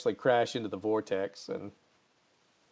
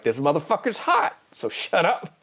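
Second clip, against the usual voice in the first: higher, exasperated retort; mock irritated snap; 6 semitones higher than usual. Spock holding hot tea, mock irritated retort. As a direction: act out a testy comeback.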